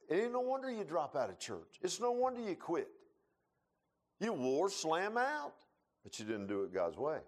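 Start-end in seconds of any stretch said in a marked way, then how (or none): none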